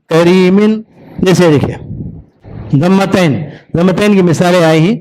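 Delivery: ordinary delivery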